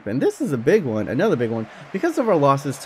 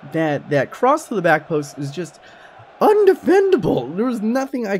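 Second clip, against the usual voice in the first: exasperated rant; 8 semitones higher, 4 dB louder than usual. Real, not acted. Ranting about back-post crosses.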